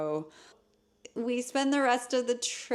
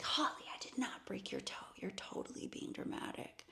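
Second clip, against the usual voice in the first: very soft, insistent whisper; hushed, whispered retold denial; whispered scolding; 4 semitones higher, 13 dB quieter than usual. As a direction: imitate a boyfriend scolding under his breath. Boyfriend's hushed scolding, acted.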